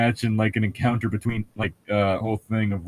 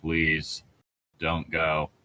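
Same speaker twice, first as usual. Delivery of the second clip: soft, lower dreamy plea; sleepy pleading; 6 semitones lower, 6 dB quieter, much slower than usual. Acted. Bella pleading in her sleep, soft and low. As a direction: murmur it like she's asleep. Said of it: "She sighed".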